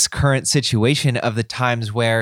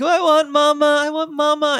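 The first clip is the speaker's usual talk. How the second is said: put-on high, whiny little-girl voice. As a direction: mimic a five-year-old pleading for mama. Dad voices crying daughter wanting mom.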